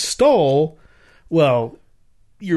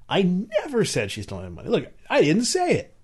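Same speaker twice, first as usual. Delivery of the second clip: sly denial; 7 semitones higher than usual. Shown as a demonstration. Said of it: "you could interpret that as".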